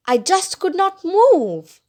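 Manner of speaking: slow, high-pitched stress through "could not move"; emphatic helplessness. A narrator's reading. Girl paralysed with fear in the car, emphatic helplessness.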